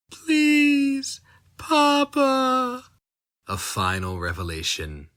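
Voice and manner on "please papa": put-on much higher, small child's plea; pleading child. Daughter begging her father, high and pleading. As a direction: beg like a frightened little girl.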